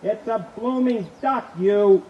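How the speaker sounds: slow, loud, high outburst; exploding husband. Acted. Husband loses his temper with wife, shouting. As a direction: lose it and shout at her.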